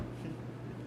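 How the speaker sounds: soft breathy chuckle; a hushed madman's giggle; quiet laugh. A narrator's reading. Madman after the murder.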